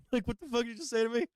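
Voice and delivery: high, fast, laughing mock outrage; laughing disbelief. Dad laughing as he repeats his retort.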